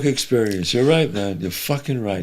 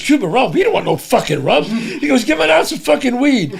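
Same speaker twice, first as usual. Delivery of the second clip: higher, mock-scoffing son; son's mocking voice; 10 semitones higher than usual.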